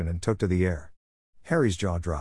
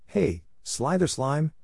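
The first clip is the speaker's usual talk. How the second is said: higher-pitched mild shout; 7 semitones higher, slower than usual. A narrator's reading. Ernie taunts the Slytherins, restrained shout. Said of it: "shouted Ernie".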